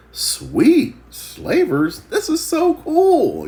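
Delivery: hammed-up chipper captive; mock cheerful slave. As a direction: play it like being sold is a treat. Joseph cheerful about being sold.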